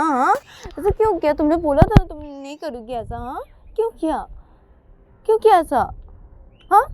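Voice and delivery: high, aching complaint; hurt reproach. Acted. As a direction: ask her why she did it. Ananya holds chest, hurt.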